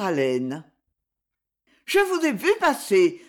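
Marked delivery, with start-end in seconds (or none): 1.9-3.2 put-on high little-girl voice, lightly breathless. A narrator's reading. Little girl has run to her friend, breathless. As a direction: pitch it high, as if just catching breath. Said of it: "dit-elle enfin d'une voix entrecoupée".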